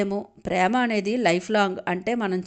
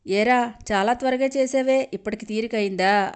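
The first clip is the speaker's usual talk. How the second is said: ordinary delivery, nothing marked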